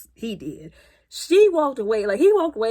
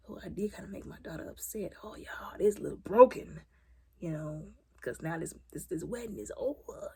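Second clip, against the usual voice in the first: soft regretful whisper; hushed musing voice; whispered inner thought; 4 semitones lower, 10 dB quieter than usual. Retold or imitated. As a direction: whisper his private doubt.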